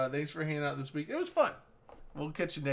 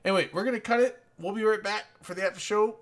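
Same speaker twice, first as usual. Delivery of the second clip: announcer sign-off; 4 semitones higher, 5 dB louder, faster than usual. Acted. Host signing off to camera.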